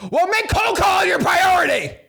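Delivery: high, loud exasperated yell; loud shouted comeback; shouted retort. Retold or imitated. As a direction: yell it back at her, fed up. Mock reply to a lazy mom.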